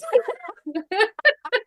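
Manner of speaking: high-pitched burst of giggling; high giggle. Real, not acted. Laughing at the host's joke about her lost trade, giggling. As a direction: giggle helplessly.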